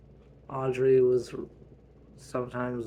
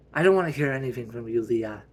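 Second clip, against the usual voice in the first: loud and fast, cutting her off; loud snap; 7 dB louder, faster than usual. Acted. Mother shouting fast at daughter Leah.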